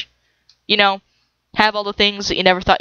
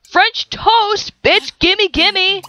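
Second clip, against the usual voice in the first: excited; 10 semitones higher, much slower than usual. Real, not acted. Excited about sim's French toast.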